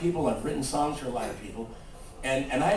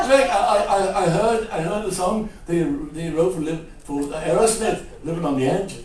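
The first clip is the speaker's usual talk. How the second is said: [name]; 3 semitones higher, 4 dB louder than usual. Retold or imitated. Rock singer praising a songwriter's song, stammering caricature. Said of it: "He goes".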